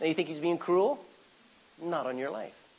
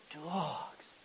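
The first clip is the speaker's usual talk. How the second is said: whisper; 13 dB quieter than usual. Acted. Jesus' word, dropped to a whisper.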